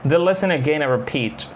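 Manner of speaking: usual speaking voice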